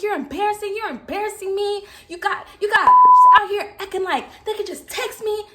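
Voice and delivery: high-pitched indignant scolding; mad retold rant. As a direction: scold him, voice pushed up. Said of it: "Y'all, I was mad".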